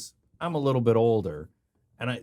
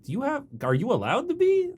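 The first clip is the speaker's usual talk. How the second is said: much higher, disbelieving question; incredulous question; 12 semitones higher than usual. Retold or imitated. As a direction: ask it in mock disbelief. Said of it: "And I'm like".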